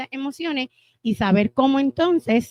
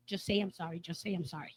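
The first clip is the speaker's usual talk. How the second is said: much softer, a hushed urging plea; kids coaxing; 4 semitones lower, 14 dB quieter than usual. Retold or imitated. Children urging their father to apologise, softly coaxing. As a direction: nudge him quietly to apologise. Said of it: "los nenes le hacen".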